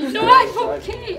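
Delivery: a wet laugh on a reassuring line; tearful laugh, waving off concern; laughing through tears. Real, not acted. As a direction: laugh it off while choked up. Emotional leader laughs through tears.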